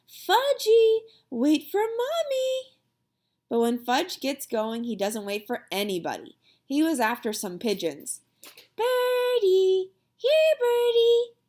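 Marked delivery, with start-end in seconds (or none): 0.1-2.8 high, loud call, dragging out Mommy slowly; hollering after a child. A narrator's reading. 8.7-11.3 put-on high, loud little-kid voice, slow on the second call; toddler calling birds. A narrator's reading. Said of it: "he called".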